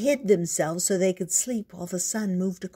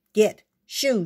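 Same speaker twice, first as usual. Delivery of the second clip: loud, higher-pitched farmer's order; raised voice, driving it away; shooing; 4 semitones higher, 6 dB louder than usual. A narrator's reading. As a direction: chase a dog off sharply. Farmer chasing off the dog.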